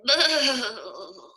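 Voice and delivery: usual speaking voice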